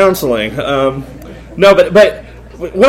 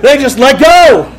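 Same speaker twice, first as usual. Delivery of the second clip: laughing punchline; 7 semitones higher, 5 dB louder, slower than usual. Real, not acted.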